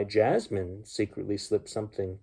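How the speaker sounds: usual speaking voice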